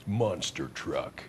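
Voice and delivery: low, slow mock announcer; hammed-up deep voiceover, drawn out; deep voice guy. Guest joining in on the voiceover guy bit, deep slow announcer voice. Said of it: "that deep voice guy that could go".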